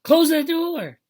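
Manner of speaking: high, loud family holler; drawn-up high yell. A narrator's reading. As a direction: holler it across the house. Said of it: "Joey all yelled".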